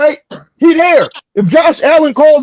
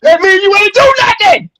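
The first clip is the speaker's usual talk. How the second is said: laughing; 8 semitones higher than usual. Real, not acted. Punchline of his own joke.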